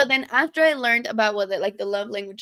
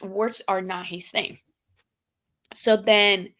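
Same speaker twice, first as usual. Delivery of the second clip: ordinary delivery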